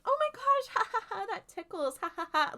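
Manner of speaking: mock tickled laughter meant to be overheard; fake giggling. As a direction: giggle as if being tickled, hammed up. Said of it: "clearly trying to make it sound like they're fucking".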